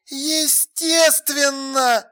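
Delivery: very slow, high, drawn-out answer; emphatic certainty. A narrator's reading. Alla confirming the police are in collusion, emphatic. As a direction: draw the word right out with conviction. Same answer about the garage.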